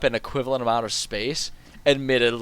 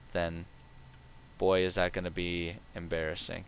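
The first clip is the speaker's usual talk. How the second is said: ordinary delivery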